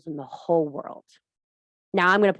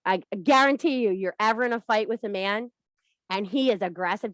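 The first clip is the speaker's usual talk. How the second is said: ordinary delivery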